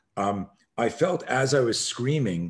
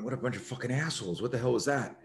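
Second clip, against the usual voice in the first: under-breath grumble; 3 semitones higher, 6 dB quieter, faster than usual. Retold or imitated. Muttering after bullies left.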